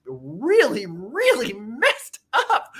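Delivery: slow, high and exasperated; drawn-out emphasis. Real, not acted. Summing up how messed up it is, drawn out. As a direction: stretch each word out, pitch soaring.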